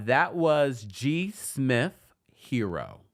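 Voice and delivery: slowly drawn-out song name. Shown as a demonstration. Song name drawn out, then repeated.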